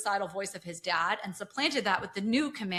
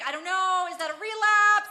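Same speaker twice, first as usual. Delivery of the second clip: high, louder mock of a flustered wife; mock Yasmin voice; 9 semitones higher, 6 dB louder than usual. Podcast host mocking the wife's dodge to her husband, high mock voice. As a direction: squeak it out like the panicked wife mimicked. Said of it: "She's like".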